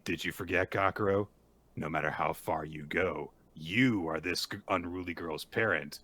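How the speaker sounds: low and hushed, like a seductive lady; low sultry Nue. Retold or imitated.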